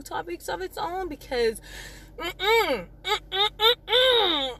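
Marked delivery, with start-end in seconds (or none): 2.2-4.6 high, emphatic "mm-mm" hum; repeated high-pitched nuh-uh hum; disapproving "mm-mm". Real, not acted. Wordless disapproving refusal.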